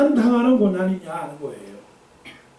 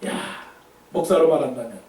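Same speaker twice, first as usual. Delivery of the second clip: ordinary talk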